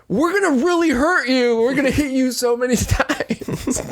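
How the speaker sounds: high, earnest mock warning; plain-worded threat. Explaining to a stranger what's coming, earnest plain threat. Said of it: "speaking in very basic terms".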